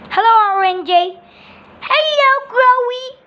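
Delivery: high, sing-song little voices; bird characters greeting. Pretend. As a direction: greet each other like cartoon birds. Crow and young bird exchange hellos.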